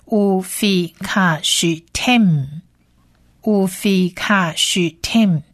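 乌菲卡许tem slowly, as "u-fi-kha-sh-tem"; 乌菲卡许tem in slow, separated syllables; whole-word pronunciation demo. Shown as a demonstration. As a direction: model 乌菲卡许tem syllable by syllable, pausing between takes. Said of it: "整体发起来会变成是".

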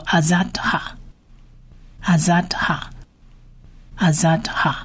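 "azadha" slowly, syllable by syllable; Hebrew word demonstrated. Shown as a demonstration. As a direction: repeat "azadha" with long pauses for learners. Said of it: "叫做". (慢速分音节示范“azadha”，每遍停顿)

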